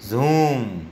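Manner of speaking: जूम with a long, held "oo"; long vowel. Shown as a demonstration. Teacher contrasting the two sounds, जूम drawn out long. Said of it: "देखिए यहाँ पर double ओ का sound कैसा है".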